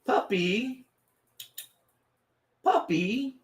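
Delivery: high, sing-song call to the puppy; calling the dog. Real, not acted. Owner calling his puppy over, high and sing-song. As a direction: coo the dog's name upward.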